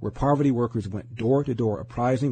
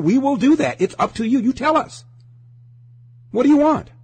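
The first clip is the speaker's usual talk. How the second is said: indignant cop voice getting louder and higher; cops speaking out, rising to a shout; 6 semitones higher, 5 dB louder than usual. Retold or imitated. Cops shouting back at the community, furious. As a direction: build from protest to a full shouted challenge.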